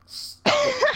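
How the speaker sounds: high-pitched real protest at a noise; calling out. Real, not acted. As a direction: squeal at someone to quit. Calling out at someone honking outside.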